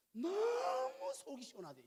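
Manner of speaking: hammed-up blissful wife; wife's relief. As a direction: overcome with relief. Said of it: "제 아내가 하는 말이".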